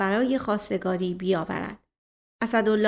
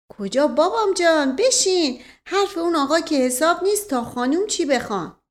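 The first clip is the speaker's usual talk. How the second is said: higher, louder cajoling; urgent coaxing; 7 semitones higher, 4 dB louder than usual. A narrator's reading. Asadollah stops the offended suitor leaving, urgent high coaxing. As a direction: call him back, insistently persuasive.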